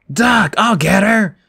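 loud, high, drawn-out battle cry; yelling. A narrator's reading. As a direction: yell while charging to the rescue. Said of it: "he yelled again".

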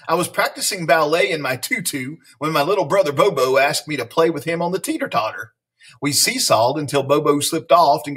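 lively read-aloud with playful bounce on silly words; animated picture-book reading; story narration. A narrator's reading. Reading a silly school story to children, animated storyteller.

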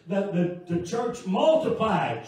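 loud, impassioned pulpit delivery; full-force preaching. Real, not acted. Preacher testifies to the congregation how God provides, loud fervent preaching. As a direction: preach it out loud, driving toward each amen.

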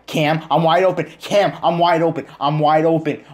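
high, loud desperate shouts; frustrated yelling. Real, not acted. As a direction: yell that you're open, again and again. Yelling at teammate Cam.

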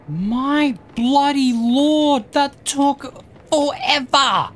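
slow, high drawn-out groan of relief; exasperated. Real, not acted. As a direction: stretch it out, fed up. Gamer exasperated after long section.

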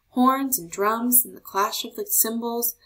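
ordinary delivery, nothing marked

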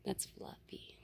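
soft, unvoiced whisper. Real, not acted. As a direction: say it under your breath. Whispering near the animal.

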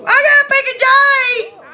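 very high, loud, slow yell; frantic outburst. Acted. Character wanting out for a big day, high loud yell. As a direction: stretch it out at the top of your range.

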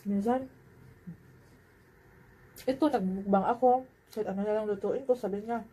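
talking while chewing; speaking around a mouthful; mouth full. Real, not acted. Seen 3: Puts food in mouth at -5; cheeks puffed, lips pressed while speaking.

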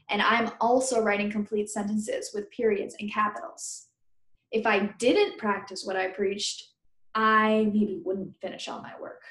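ordinary talk, nothing marked

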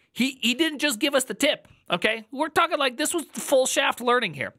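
high-pitched excited gushing. Real, not acted. Viewer praising a refreshingly direct tutorial, very excited. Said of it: "I'm very excited about this".

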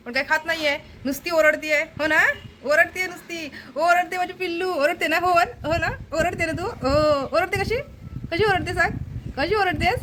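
high, cooing sing-song; doting pet talk. Real, not acted. Fussing over the noisy pet. (लाडीक, उंच स्वरात बोलणे)

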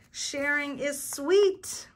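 high, loud, drawn-out cheer; big storybook finale; joyful cry. A narrator's reading. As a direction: cry it out slowly in triumph. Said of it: "cried Clark the shark".

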